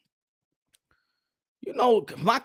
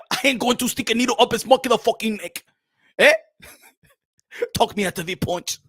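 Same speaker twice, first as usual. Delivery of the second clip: hammed-up African boxer threat; ominous accent broken by laughter; menacing boxer act, half cracking up; Makabu; 5 semitones higher, 6 dB louder than usual.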